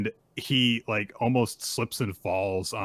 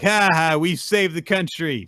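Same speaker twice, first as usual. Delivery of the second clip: loud, high, crowing mock triumph; hammed-up childish gloating; gloating kids; 10 semitones higher, 10 dB louder than usual. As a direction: crow like victorious playground bullies. Kids gloat after chasing him off.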